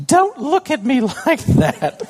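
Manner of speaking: put-on high, slow, laughing little girl; daughter protesting. Daughter telling daddy not to look at her, high and laughing. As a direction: whine it like a sulky child. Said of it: "And then she, you know".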